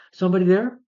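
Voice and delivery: high, loud questioning call. Retold or imitated. Norman drinking at home hears someone, loud wary call. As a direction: imitate calling into an empty house.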